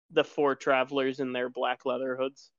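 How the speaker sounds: normal talking voice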